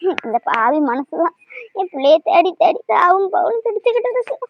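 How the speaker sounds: high, aching wail of a grieving mother; anguished lament. Acted. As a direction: pour out the grief for a lost daughter. Mother laments missing daughter.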